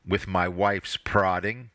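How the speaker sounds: usual speaking voice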